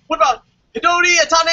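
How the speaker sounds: pitching a silly name brightly; mock WWE suggestion. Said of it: "And then like".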